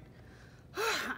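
acted big weary exhale; storybook puff of exhaustion; exhausted sigh. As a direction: heave a tired donkey's sigh. Tired donkey at the market.

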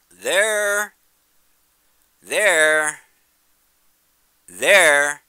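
loud, high-pitched calls, one per word; excited pointing. Real, not acted. Pointing excitedly at object in sky.

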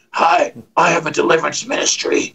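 demon voice. Pretend. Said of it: "you start sounding like a demon".

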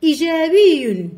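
إيجابي opened loud and high on glottal i; hamza pronounced. Shown as a demonstration. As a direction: punch the first glottal i of إيجابي. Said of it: "ضروري أن ننطق بها".

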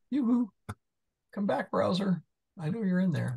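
high sing-song call to a lost window; playful coaxing. Pretend. Tutor calling to a vanished browser window, playful high coaxing. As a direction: coax it back like a pet.

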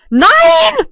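very high loud scream of no; shrill loud no; frustrated shout. Real, not acted. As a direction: scream it at the screen. Loses again.

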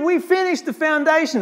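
high, jubilant exclamation; mock cheering. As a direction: imitate an excited crowd without screaming. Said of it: "Screaming and cheering".

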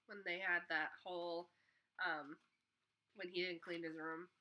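plain reading voice

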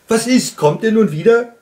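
loud, high called question; high-pitched hailing call. A narrator's reading. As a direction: shout the question across the distance. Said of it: "rief der Wunschhase".

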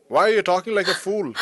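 ordinary delivery

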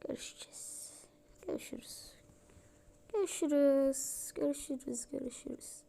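unvoiced whispered goodbye. Character whispers a menacing goodbye.

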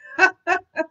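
high, loud burst of laughter. Real, not acted. Reader breaking off the story, bursting out laughing.

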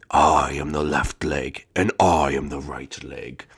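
very low, slow menacing voice; slow boss-creature rumble; deep monster limb voice. Acted. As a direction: growl it like a giant's leg. Voicing the boss limbs.

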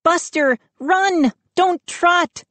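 put-on high, desperate girl's plea; Mindy begging. A narrator's reading. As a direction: beg the dog to run. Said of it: "Mindy begged".

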